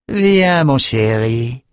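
a sudden high "Viens", then a slow sneering "mon chéri"; mocking endearment hissed at her enemy. A narrator's reading. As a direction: taunt him like a cruel lover. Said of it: "siffla-t-elle avec un rictus".

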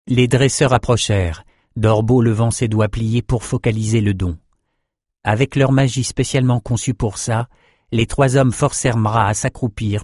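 ordinary delivery

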